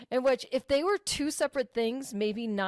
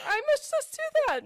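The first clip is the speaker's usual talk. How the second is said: very high ditzy voice; airhead voice. Retold or imitated. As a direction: play a blank-eyed goldfish brain. Mimics friend's blank thinking.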